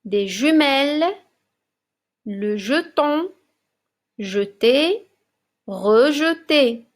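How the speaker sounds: each word read out alone, evenly paced; slow reading drill. Shown as a demonstration. Teacher reading a word list aloud to young pupils, clear and measured.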